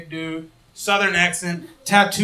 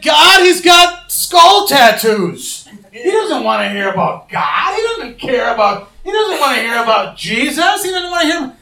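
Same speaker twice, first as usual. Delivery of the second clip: whiny protest to God; 8 semitones higher, 6 dB louder than usual. Retold or imitated. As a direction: plead your way out of the task. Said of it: "And I said".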